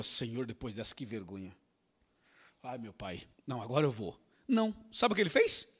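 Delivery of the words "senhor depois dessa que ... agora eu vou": much softer, lower, embarrassed; softly ashamed Moses; ashamed murmur. Acted. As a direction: mumble it in shame.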